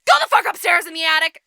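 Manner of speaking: sudden loud bellowed order; stepmother's angry shout. Retold or imitated. Stepmother sends Cinderella up to the attic, shouting.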